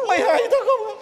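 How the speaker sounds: high, whiny tearful complaint; mock crying. Accused helper is asked why he is crying, mock crying. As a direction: wail it as a comic sob. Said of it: "Mày khóc cái gì?".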